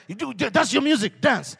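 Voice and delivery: loud, high crowd command; crowd shouting. Retold or imitated. Crowd yelling at the dancer, loud and high. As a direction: bark it like a pushy crowd. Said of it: "The crowd is like".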